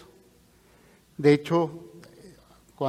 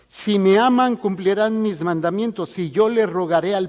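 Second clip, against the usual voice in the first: raised, louder preaching voice; scripture proclamation; 5 semitones higher, 6 dB louder than usual. Acted. Preacher quotes the Lord to the disciples, raised solemn proclamation. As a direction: proclaim it high and strong to the congregation.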